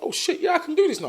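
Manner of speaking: suddenly thrilled you can do it now; a high, loud retold eureka; excited realization. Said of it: "I'm like".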